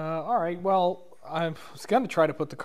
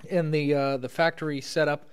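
ordinary talk

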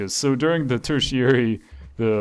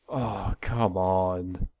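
low, slow, drawn-out sigh of defeat; defeated groan; 5 semitones lower, 5 dB quieter, much slower than usual. Real, not acted. Player giving up on a stubborn dinosaur, slow defeated groan. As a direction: let it sag out of you.

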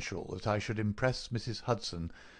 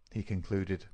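plain reading voice

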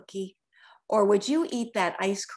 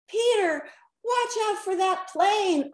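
higher warning shout; yelled; 8 semitones higher than usual. A narrator's reading. Pinkalicious warning Peter of a plane, yelling. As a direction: call out sharply to Peter. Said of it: "I yelled".

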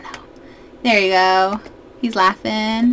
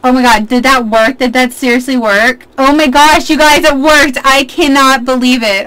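thrilled disbelief; 3 semitones higher, 6 dB louder than usual. Real, not acted. Player tells viewers the last-second attempt worked, thrilled disbelief. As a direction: burst out loud, stunned it succeeded.

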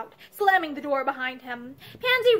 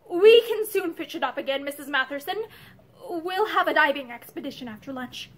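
plain reading voice

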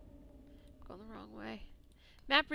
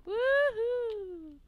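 cheer; 12 semitones higher, 7 dB louder than usual. Acted. Freed prisoner cheers.

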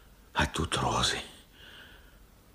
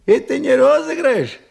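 high, loud startled question; suspicious; 9 dB louder than usual. Acted. Old man asks the caller, startled, whether it is a prank. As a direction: blurt out the suspicion.

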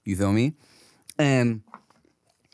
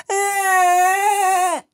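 high, loud mock toddler wail; mimicked fake cry; 5 dB louder than usual. Dad mimics daughter wailing while watching cartoons, mock cry. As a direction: imitate a toddler's put-on cry. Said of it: "And it's, like, smiling".

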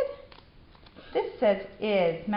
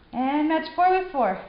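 high, bright, louder praise; excited; 7 semitones higher, 6 dB louder than usual. Real, not acted. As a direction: cheer him on excitedly. Praising child during reading drill.

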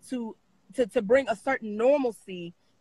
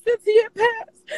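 real crying; 9 semitones higher, 7 dB louder than usual. Real, not acted. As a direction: push the words out through tears. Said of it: "I'm sorry that I'm crying".

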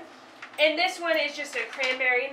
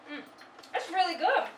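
talking while chewing food; muffled through snack bite; mouth full, chewing; 3 semitones higher than usual. Real, not acted. Seen 1: Bean goes in mouth at -1.0, lips pressed chewing while speaking.

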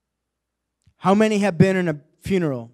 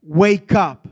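loud, commanding cry of wake up; a preacher voicing Jesus, loud and commanding; commanding call; 10 dB louder, much slower than usual. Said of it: "Jesus is talking to you today and saying".

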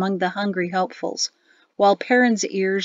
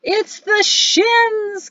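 high, drawn-out bellow; big jovial announcement, stretched out; joyful bellow; 13 semitones higher, 4 dB louder, much slower than usual. A narrator's reading. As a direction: bellow it slowly with delight. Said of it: "Gazada bellowed".

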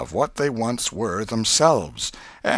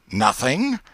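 rising, disbelieving question; incredulous echo; 5 semitones higher, 4 dB louder than usual. A narrator's reading. Aunt doubting the boy's denial, incredulous echo. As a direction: throw the word back as a doubting question. Aunt throws his answer back.

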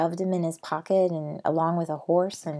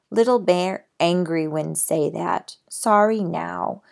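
louder broken-English demands of a proud Indian brave; Little Bear, demanding; 8 dB louder than usual. Acted. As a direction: list what he wants, bold and insistent. Little Bear speaks in the story.

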